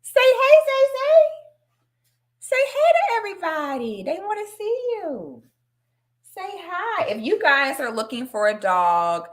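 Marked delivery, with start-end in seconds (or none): 0.0-7.1 high-pitched cooing to a pet; excited high sing-song, softening later. Real, not acted. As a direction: coax the dog to greet everyone. Coaxes dog to greet viewers.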